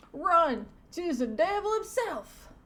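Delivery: loud, high hammed-up terror; panicked cartoon cop. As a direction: cry a warning in mock panic. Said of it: "stereotype cop, he says".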